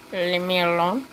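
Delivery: cat voice. Pretend. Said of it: "You just say".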